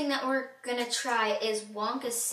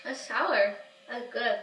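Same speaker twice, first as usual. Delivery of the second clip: talking while sucking candy; candy in mouth; 4 dB louder than usual. Real, not acted. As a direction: sour face puckered mouth. Seen 0: Dark-haired girl puts candy in mouth, then grimaces sourly while talking.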